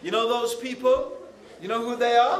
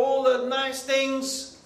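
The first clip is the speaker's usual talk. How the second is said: ordinary delivery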